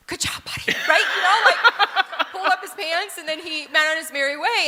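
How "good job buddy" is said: whispered praise. Retold or imitated. Mom whispering praise to son during her call. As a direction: whisper encouragement while on the phone.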